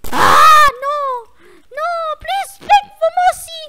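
high shrieking plea for mercy; mock begging scream.

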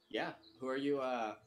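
normal talking voice

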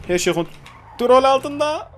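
high, slow, strained "everything's fine"; forced calm, voice climbing high; mock reassurance. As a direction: insist it's under control, slowly and high. Reassures while losing control.

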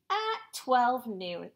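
ordinary delivery, nothing marked

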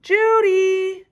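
loud high call across the yard. Acted. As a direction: call her name from the house. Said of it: "called her dad".

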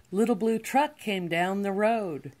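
usual speaking voice

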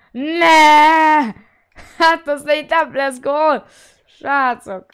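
loud, high panicked yell; panicked shout. Real, not acted. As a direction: shout in alarm as a goal looms. Opponent's chance.

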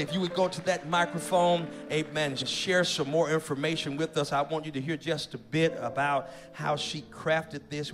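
ordinary talk

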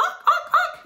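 high-pitched repeated parrot squawks. Shown as a demonstration. Teacher showing kids the parrot cheer, high squawking. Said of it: "the chair we're gonna do is parrot".

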